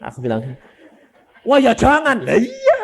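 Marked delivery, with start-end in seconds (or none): 1.4-2.8 high, loud laughing protest; laughing reply. Retold or imitated. Son joking with his father about the coffin, laughing. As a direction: laugh it off, louder and higher.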